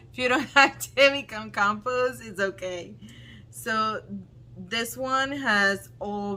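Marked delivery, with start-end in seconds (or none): 0.1-3.0 laughing tease. Real, not acted. Laughing teasing with partner.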